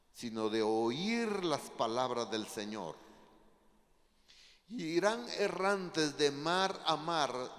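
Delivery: loud, slow, weighty Bible reading; proclaimed scripture reading. Real, not acted. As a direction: declaim each verse slowly and forcefully, stretching key words. Preacher reads scripture aloud.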